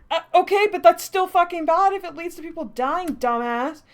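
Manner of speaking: loud, high, exasperated yelling at the book; furious loud rant; angry outburst. Real, not acted. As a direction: shout it at the screen in disbelief.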